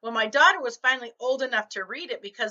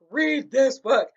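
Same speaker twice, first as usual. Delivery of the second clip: "Read this book" slow, louder, each word pressed; emphatic urging; 2 semitones higher, 7 dB louder, slower than usual. Retold or imitated. Writer urging lunch friends, "Read this book" slow and pressed. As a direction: insist with "Read this book" drawn out and punched. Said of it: "You gotta read this book".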